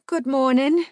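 high, loud courtly greeting; polite stranger. A narrator's reading. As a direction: greet her like a gracious stranger.

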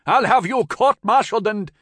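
high, loud blustering threat; furious threat. A narrator's reading. Lieutenant threatening the soldier on the ferry, furious bluster. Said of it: "wagging furiously as though the mere threat".

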